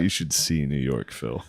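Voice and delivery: slow, quiet know-it-all; lightly voiced big-city snob; mock snob. Snobby quote to Phil.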